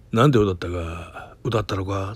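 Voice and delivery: a hint of a slow, low, stoic film hero; Takakura Ken style. The boss asking what he sang, in a lightly voiced Takakura Ken manner. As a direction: play it like a grave movie scene, lightly. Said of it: "ほんま高倉健、映画のワンシーンみたいな".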